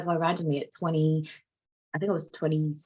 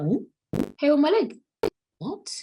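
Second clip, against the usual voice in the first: high, loud, incredulous squeak; shocked disbelief; 10 semitones higher, 3 dB louder than usual. Retold or imitated. Retelling spotting hair on her leg, shocked disbelief.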